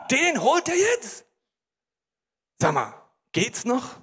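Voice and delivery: acted outrage, high drawn-out first question; mock indignation; indignant crowd at its limit. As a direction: protest in disbelief, then a pointed follow-up. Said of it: "die frommen Juden sind am Limit".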